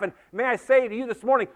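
ordinary talk, nothing marked

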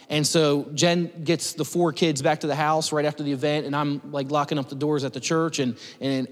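normal talking voice

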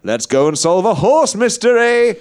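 rousing cowboy call. Acted. Cowboy rallying the group.

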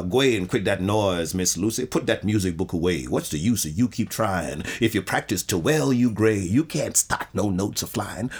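reciting the poem in broken Southern dialect; dialect poem recital. Shown as a demonstration. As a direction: read it in a lilting old Southern dialect. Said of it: "what is called the crooked language".

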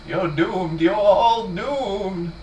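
slow, high, eerie warning voice; Ralph's slow ominous drawl. Retold or imitated. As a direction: drag out the doom like a crazed prophet.

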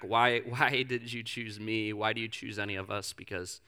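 soft, low, hushed prayer voice. Acted. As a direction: pray quietly and humbly. Leads a prayer.

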